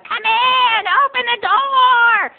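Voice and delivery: high, loud, drawn-out shriek; hollering grandma. Retold or imitated. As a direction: holler from bed like a batty old lady. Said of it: "Grandma lying on the bed".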